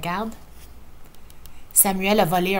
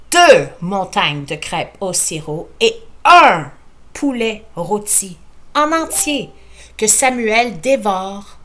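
ordinary delivery, nothing marked

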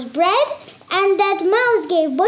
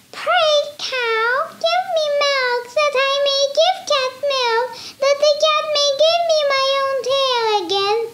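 high, squeaky pleading mouse; a tiny mouse begging, speeding up; little mouse voice; 8 semitones higher than usual. Acted.

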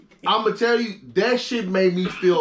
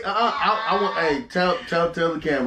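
ordinary delivery

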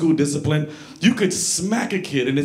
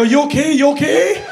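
high, loud, rising question; raised-pitch anxious check-in; worried checking; 11 semitones higher, 7 dB louder than usual. Acted. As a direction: call out to check on grandpa. Acts checking on straining grandpa.

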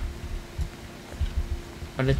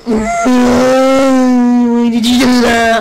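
drawn-out loud wail, higher; slow anguished yell; 8 semitones higher, 9 dB louder, much slower than usual. Acted. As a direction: howl each word slowly in pain. Character set on fire.

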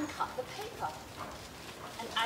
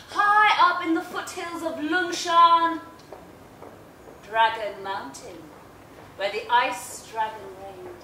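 louder, grand once-upon-a-time telling; storytelling; 8 dB louder than usual. A narrator's reading. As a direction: open the tale big and grand. Opens the fairy tale.

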